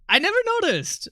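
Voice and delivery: squeaky high-pitched voice; high mock voice. High mock line after laugh.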